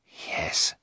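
soft, breath-only whisper. A narrator's reading. Sick Jonathan agrees to a new life with Mina, whispered. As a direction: whisper a weak assent. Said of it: "Jonathan whispered".

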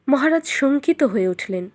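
usual speaking voice

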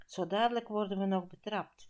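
ordinary delivery

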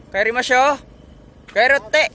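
loud, high call to leave; pasigaw na pag-aya. Real, not acted. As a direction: call out loudly in Japanese. Calls companion to go home. (malakas at mataas na pagtawag)

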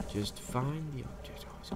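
soft, near-whispered self-instruction; hushed. Real, not acted. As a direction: whisper it to yourself while searching. Hiding from monster, searching.

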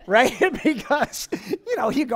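talking through real laughter; loud laughing aside; real laugh breaking into the words. Real, not acted. Speaker laughing at his story.

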